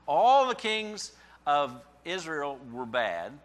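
slow, loud, weight on all; slow emphatic statement. Real, not acted. Preacher to congregation, slow and loud. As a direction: hammer each word home.

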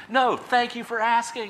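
a hint of the organizer gushing; mock cheerful reply. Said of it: "He said".

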